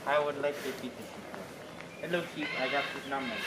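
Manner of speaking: quiet and gentle; soft. Real, not acted. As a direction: coax the sheep softly. Talking softly to the sheep.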